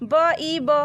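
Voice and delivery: each sound apart and clearly punched; sounding out letter by letter. Shown as a demonstration. Teacher to pupils, sounding out বিব. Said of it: "এইভাবে পড়তে পারো".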